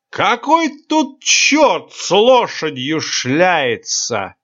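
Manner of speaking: slow, high slurred yell; drunken shout. A narrator's reading. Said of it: "совершенно пьяным голосом".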